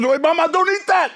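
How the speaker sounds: urgent high yelp; high warning shout. Retold or imitated. Retelling friend's frantic warning.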